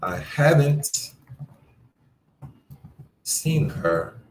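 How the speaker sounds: very slow, drawn-out I haven't; haven't stretched long; slow model read. Shown as a demonstration. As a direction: spell it out slowly for the class. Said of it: "here's another one".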